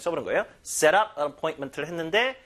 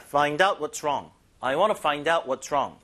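slow model phrase; much slower than usual. Shown as a demonstration. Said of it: "무엇이 문제인지를 알아보다".

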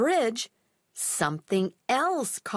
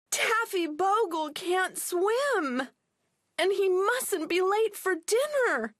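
wailed; 8 semitones higher than usual. Acted. Molly wails to the pilot about her dog, high wail. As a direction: wail out the worry. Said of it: "wailed Molly".

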